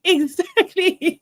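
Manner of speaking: drawn-out laughing exactly. Real, not acted. Host laughing in agreement with a viewer. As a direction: stretch it through a laugh.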